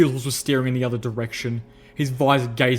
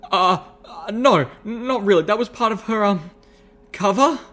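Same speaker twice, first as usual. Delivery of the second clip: high, stammering, nervous excuse; flustered backpedalling; 9 semitones higher, 5 dB louder than usual. A narrator's reading. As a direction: grope for words, ending on a squeaked question. Flustered protagonist improvises an excuse.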